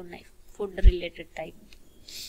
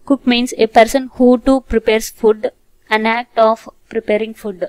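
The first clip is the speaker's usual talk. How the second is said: slow, deliberate reading of a definition; slow dictation; 7 dB louder, much slower than usual. Shown as a demonstration. Teacher dictating an English definition to students, slow and deliberate. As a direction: read it out word by word for the class.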